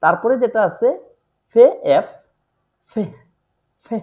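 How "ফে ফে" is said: ফে with lips popped into f; ফে, German pf cluster; German pf sound. Shown as a demonstration. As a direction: say ফে as a tight pf. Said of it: "এরকম উচ্চারণ দিয়ে থাকে".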